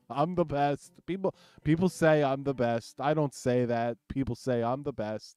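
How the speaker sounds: Trump. Pretend. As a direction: brag like a blustering politician. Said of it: "Trump impersonators".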